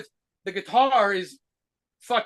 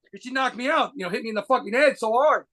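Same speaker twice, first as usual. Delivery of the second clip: normal talking voice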